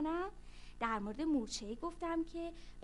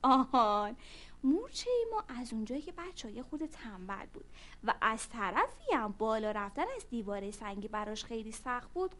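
ordinary delivery

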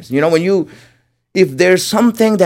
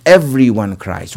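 ordinary talk

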